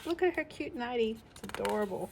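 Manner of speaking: plain reading voice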